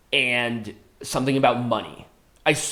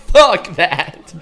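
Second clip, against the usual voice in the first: laughing; 4 semitones higher, 4 dB louder than usual. Real, not acted. Laughing at the clip.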